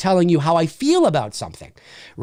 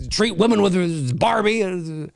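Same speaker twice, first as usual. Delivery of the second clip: ordinary delivery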